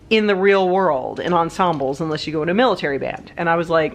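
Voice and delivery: ordinary talk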